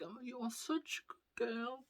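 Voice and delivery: high, soft, choked-up praise; mock tearful mum. Mum praising her daughter, lightly mimicked tearfulness. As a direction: imitate a mum welling up.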